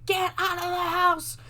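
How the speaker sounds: high urgent cry; urgent warning. Retold or imitated. Son urgently warning mom.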